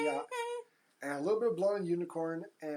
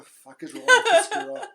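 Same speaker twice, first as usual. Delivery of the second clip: much higher and louder, laughing through it; laughing outburst; 15 dB louder than usual. Retold or imitated. Baffled by the character's changing accents, loud laughing outburst. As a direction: blurt it out in laughing disbelief.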